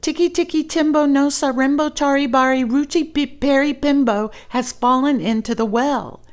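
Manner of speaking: put-on high, loud, fast breathless boy; breathless rush. Chang rushing the name to his mother, breathless and fast. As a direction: race through the long name panting. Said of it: "he panted".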